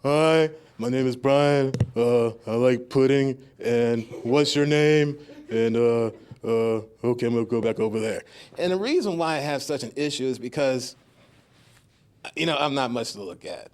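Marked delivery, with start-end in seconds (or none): 0.0-8.2 louder, slow awkward self; awkward first date. Retold or imitated. Comic acting his nervous first-date self in a slow, awkward voice. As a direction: mumble a shy nervous introduction. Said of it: "for me, it is".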